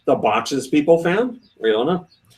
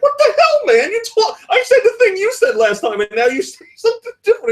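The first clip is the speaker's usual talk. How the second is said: very high, exasperated comic yelling; mock outraged outburst; 3 dB louder than usual. Designer protesting boss's changed answer, mock outrage. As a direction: squawk it in hammed-up indignation. Retold outburst at contradicting boss.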